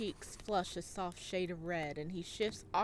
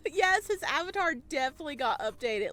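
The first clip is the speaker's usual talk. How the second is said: loud, excited gushing with laughter; 4 semitones higher, 8 dB louder than usual. Real, not acted. Streamer gushing over Jim's updated avatar, loud and excited. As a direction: fangirl over the new art.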